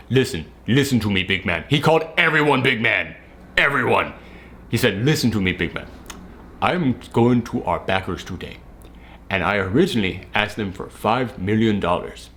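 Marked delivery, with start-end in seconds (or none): 0.1-1.6 lowered African accent impression of the boss; imitated African accent. Boss buttonholing his employee, imitated African accent. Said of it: "he had the thickest African accent". 5.0-12.3 put-on low, softer, deliberate African accent; solemn pitch in a put-on African accent; imitated African accent. Boss announcing his plan to backers, imitated African accent. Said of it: "he had the thickest African accent".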